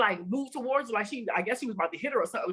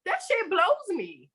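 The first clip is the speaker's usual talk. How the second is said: exasperated; 9 semitones higher, slower than usual. Real, not acted. Exasperated reaction to assumptions.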